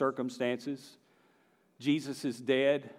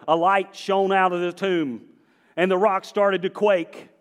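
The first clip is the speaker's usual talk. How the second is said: raised, punchy pulpit voice; emphatic preaching; 6 semitones higher, 7 dB louder than usual. Real, not acted. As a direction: preach it out to the congregation.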